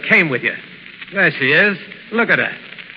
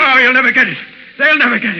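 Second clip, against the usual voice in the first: loud, higher refusal; defiant shout; 6 semitones higher, 5 dB louder, faster than usual. Acted. Burkett shouting defiance at the Shadow about the money. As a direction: throw it in his face.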